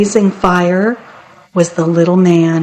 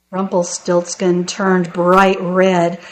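usual speaking voice

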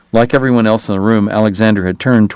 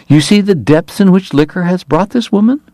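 high, preachy disapproval; scandalised pious matron lecturing; sanctimonious scold; 9 semitones higher than usual. Acted. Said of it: "Margaret Hoover asked".

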